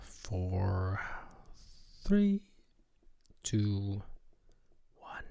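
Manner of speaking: soft, mostly low counting with long pauses; calming countdown. Acted. Host calming herself before going back on air, hushed count. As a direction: count yourself down quietly.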